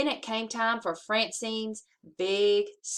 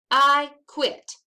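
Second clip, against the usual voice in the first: angry ultimatum; 4 semitones higher, 8 dB louder than usual. Acted. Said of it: "said Francine".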